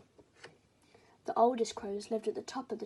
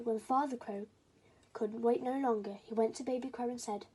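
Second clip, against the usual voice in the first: plain reading voice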